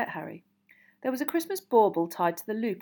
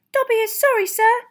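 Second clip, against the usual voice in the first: anxious, very high elf squeak; Dobby; 11 dB louder than usual. A narrator's reading. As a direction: squeak a loud, nervous apology. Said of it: "squeaked Dobby anxiously".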